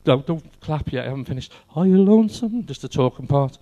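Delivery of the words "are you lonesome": a touch of [name] crooning, louder; half-sung [name] line. Acted. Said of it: "in his voice".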